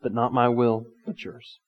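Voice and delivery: soft, low, yielding prayer; retold prayer, hushed and accepting; trusting surrender. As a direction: let the voice sink away in submission. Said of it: "there was that trust".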